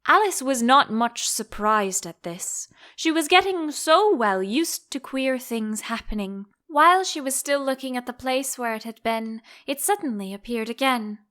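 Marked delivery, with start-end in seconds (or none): none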